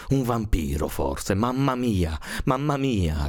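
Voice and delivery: put-on high, frightened little girl's cry, restrained in volume. A narrator's reading. Little girl fears a vampire underfoot, high alarmed cry. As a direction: cry out in alarm, pitch leaping up. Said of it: "gridò la piccola piccina a squarciagola".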